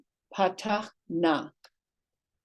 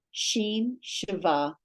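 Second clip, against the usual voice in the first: ordinary delivery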